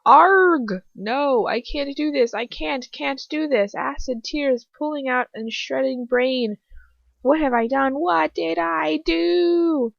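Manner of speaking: high, loud cries of pain; agonised desperation. A narrator's reading. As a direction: build to a long, wailing plea. Victim under torture again.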